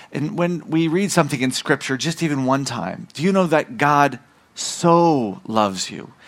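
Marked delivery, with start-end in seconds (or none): 3.8-6.1 "so" stretched long and slow; emphatic "so". Shown as a demonstration. Preacher assuring the congregation of unconditional love, "so" emphatic. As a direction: hold "so" with force before "loves you". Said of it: "It's an emphatic".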